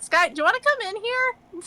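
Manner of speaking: high, sing-out invitation; calling out. Acted. Poking her head out of the tent to Sky, calling high. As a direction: pitch it up to carry outside. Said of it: "poke her head out like".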